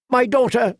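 high, pleading cry of a mother. A narrator's reading. Mother crying out for her missing daughter, high and pleading. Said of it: "cried Lady Tanda".

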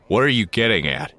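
sharp, loud demand. Acted. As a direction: press him for an answer, loud. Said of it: "Han demanded".

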